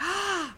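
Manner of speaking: sharp shocked gasp, pitched high; retold gasp. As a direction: gasp as if scandalized.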